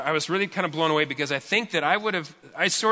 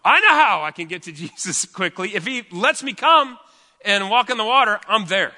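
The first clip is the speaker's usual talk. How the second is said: eager Peter; 6 semitones higher, 7 dB louder than usual. Retold or imitated.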